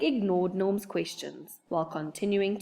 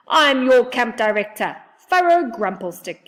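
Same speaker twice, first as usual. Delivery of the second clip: louder, blunt gnome voice; grumpy gnome; 5 semitones higher, 10 dB louder than usual. Acted. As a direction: announce yourself like a cranky little gnome. Grumpy gnome introduces himself.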